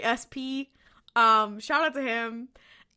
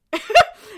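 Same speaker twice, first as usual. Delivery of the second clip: burst of laughter; 11 semitones higher, 14 dB louder than usual. Real, not acted. Laughing at cheesy line.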